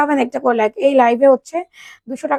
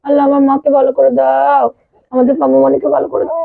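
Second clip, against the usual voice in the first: louder pleading prayer; 6 dB louder than usual. Real, not acted. Mother praying aloud for her hurt daughter, pleading. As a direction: beg God to heal her.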